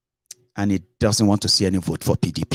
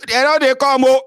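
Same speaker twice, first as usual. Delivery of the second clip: very high, louder warning cry; imitated lookout's alarm; 5 dB louder than usual. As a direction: call out the alarm to everyone. Acts lookouts warning of people coming.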